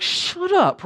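sudden high, loud shout-down; sparring retort. Pretend. Pastor shouting down the inner critic, high and loud. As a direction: snap it at the inner voice like a jab. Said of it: "like you're sparring".

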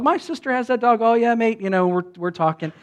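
usual speaking voice

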